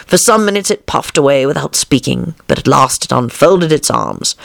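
ordinary delivery

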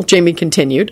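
usual speaking voice

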